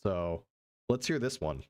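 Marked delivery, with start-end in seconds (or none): 0.0-0.4 mock deep guys. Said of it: "the dude before him said".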